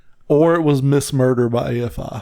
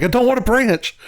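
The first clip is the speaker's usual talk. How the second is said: high-pitched acted protest; exasperated retort; 11 semitones higher than usual. Turning down an invisible beaver familiar, high mock protest.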